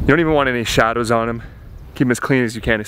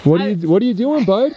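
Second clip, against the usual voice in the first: high coaxing animal talk; 12 semitones higher than usual. Real, not acted. Talking to calf in field.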